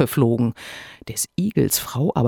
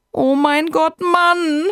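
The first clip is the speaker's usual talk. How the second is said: shrill-high, louder shocked cry; screaming wife; 4 dB louder, slower than usual. A narrator's reading. Hedgehog's wife hears about the bet, screaming. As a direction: wail at him in horror. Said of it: "fing nun des Igels Frau an zu schreien".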